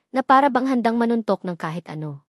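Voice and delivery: ordinary delivery, nothing marked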